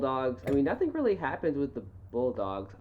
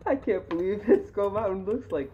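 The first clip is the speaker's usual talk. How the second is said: high, loud words through real laughter; can barely say it for laughing; helpless amused disbelief, pitched up; 7 semitones higher, 6 dB louder than usual. Real, not acted. Cracking up at the reveal.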